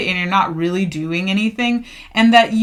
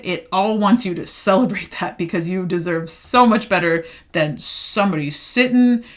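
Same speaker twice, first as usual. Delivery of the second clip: ordinary talk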